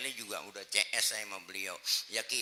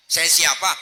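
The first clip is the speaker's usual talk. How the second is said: high, very loud questioning; loud challenge; 7 semitones higher, 16 dB louder than usual. Acted. Sick man demanding the visitor's name, loud challenge. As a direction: fire the question back loudly.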